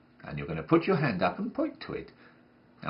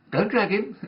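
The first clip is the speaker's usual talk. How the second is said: sharp scold; 9 semitones higher, 6 dB louder than usual. Acted. Scolding child at rehearsal.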